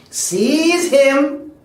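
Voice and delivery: loud, high order to capture; sudden full-voiced shout; shouted command. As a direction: yell it as the master's furious order. Master orders Joe caught.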